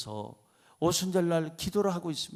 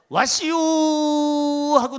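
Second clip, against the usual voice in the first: higher, louder Chungcheong dialect call; 8 semitones higher, 8 dB louder than usual. Retold or imitated. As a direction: let the Chungcheong dialect slip out. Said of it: "충청도 사투리가 막 저절로". (저절로 튀어나온 충청도 사투리)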